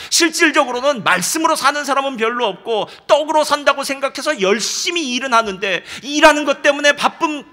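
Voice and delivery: loud, driving sermon rebuke; full-force preaching. Acted. Pastor rebuking busy believers, loud and urgent. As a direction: hammer the point home to the church.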